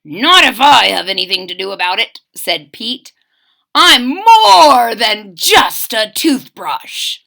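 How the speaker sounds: loud, brave toothbrush declaration; mildly heroic vow; determined hero. Acted. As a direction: stand up proud and defiant. Brave Pete vows rescue.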